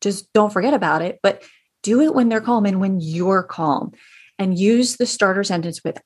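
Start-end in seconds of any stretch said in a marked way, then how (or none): none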